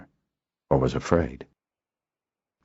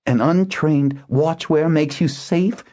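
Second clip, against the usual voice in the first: high, slow incredulous question; incredulous, drawn out and pitched up; cry of disbelief; 12 semitones higher, slower than usual. A narrator's reading. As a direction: challenge it in utter disbelief. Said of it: "Zener cried in disbelief".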